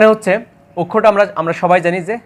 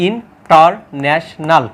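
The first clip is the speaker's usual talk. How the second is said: slow syllable demo; much slower than usual. Shown as a demonstration. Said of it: "অর্থাৎ একটা, দুইটা".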